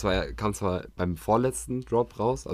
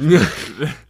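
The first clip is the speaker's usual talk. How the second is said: loud laugh; 4 semitones higher, 9 dB louder than usual. Real, not acted. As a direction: burst of high laughter. Laughs at own joke.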